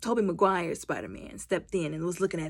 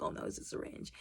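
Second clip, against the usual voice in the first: whispered realization; 16 dB quieter, faster than usual. Real, not acted. Realizing mid-scene.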